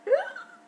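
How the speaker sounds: sharp high gasp of alarm; shocked gasp. Retold or imitated. Retelling finding blood after sex, a high shocked gasp. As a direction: gasp like you just saw blood.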